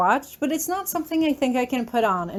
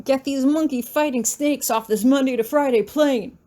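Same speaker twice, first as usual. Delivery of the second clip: higher-pitched mock movie-hero outburst; Samuel L. Jackson; 4 semitones higher, 4 dB louder than usual. Narrator joking with a famous film line, mock outburst. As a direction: quote the film line with emphatic punch. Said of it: "it does have Samuel L. Jackson".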